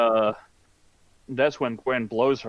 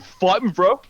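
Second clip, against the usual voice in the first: loud, high taunt building higher and louder; mock challenge; 14 semitones higher, 8 dB louder than usual. Villain calling Peter out to fight, escalating mock challenge. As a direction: goad him, jumping up in pitch on the repeat. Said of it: "He's like".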